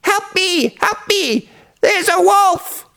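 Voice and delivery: put-on very high little-boy voice calling out falsely; mock shout for help. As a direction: squeal for help without real volume. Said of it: "and then shouted".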